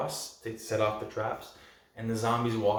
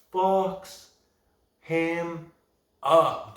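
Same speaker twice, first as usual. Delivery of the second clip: "fucks", "him", "up" each punched alone, pitched high; long pauses splitting "fucks ... him ... up"; word-by-word emphasis; 5 semitones higher, 5 dB louder, much slower than usual. Real, not acted. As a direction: hammer "fucks him up" one word at a time. Stressing each word for impact.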